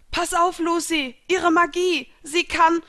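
high, loud alarm; urgent warning. A narrator's reading. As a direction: warn a friend urgently, cut off at the end. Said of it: "setzte Gray an".

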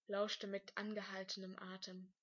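ordinary delivery, nothing marked

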